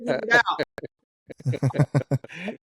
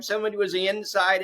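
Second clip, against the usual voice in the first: ordinary delivery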